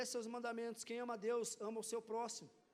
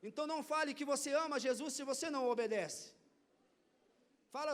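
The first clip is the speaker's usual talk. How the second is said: ordinary talk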